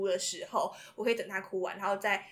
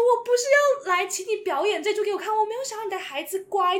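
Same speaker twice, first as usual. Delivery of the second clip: higher, louder, nervous rush of words; anxious inner plea; 11 semitones higher, 7 dB louder than usual. Retold or imitated. Tutor silently begging the father to stop scolding, nervous high plea. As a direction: plead in your head while squirming. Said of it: "我在旁边就如坐针毡".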